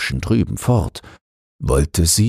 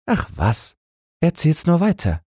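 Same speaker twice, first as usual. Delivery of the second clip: lightly voiced woman, higher, calling out eagerly; an eager woman urging him on, higher voice; Annemarie calling out; 13 semitones higher than usual. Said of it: "rief Annemarie". (Annemarie, höher gesprochen, ruft neugierig drängend)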